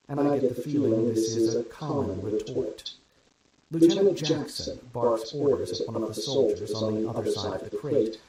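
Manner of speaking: usual speaking voice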